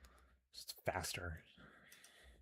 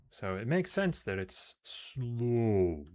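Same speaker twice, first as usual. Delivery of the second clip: slow, stretched-out "slow"; drawn-out slow; 4 semitones higher, 4 dB louder, much slower than usual. Shown as a demonstration. Joking about a turtle-named ship, dragging it out. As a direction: drag the last word out like a turtle crawling.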